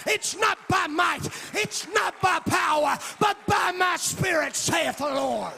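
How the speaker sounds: loud, high, sing-song preaching cadence; full-force preaching. Acted. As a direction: preach it out, rising and ringing over the music.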